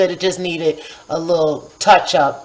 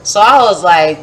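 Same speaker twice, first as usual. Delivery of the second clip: ordinary talk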